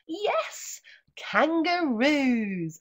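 whispered yes, then a slow loud kangaroos; big reveal for the class; excited reveal. Acted. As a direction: stretch the word out with delight. Reveal of page.

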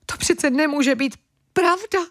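high, sobbing wail of disbelief; high tearful sob; crying in disbelief. Acted. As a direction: weep out the words, voice rising. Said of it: "plakala Amelína".

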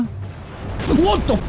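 sudden shriek, way up high; startled squeal at the top of the voice; high scream. Real, not acted. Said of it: "I just screamed so high".

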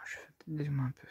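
quick unvoiced whispered aside; hushed muttered aside. Real, not acted. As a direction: whisper it to yourself while fiddling. Quick aside while handling camera.

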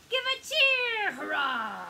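high, loud cheer; excited pirate hurrah. A narrator's reading. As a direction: throw your arms up and cheer.

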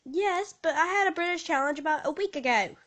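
put-on British accent. Answering about the British challenge, put-on British accent. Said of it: "I'm gonna speak British this whole episode".

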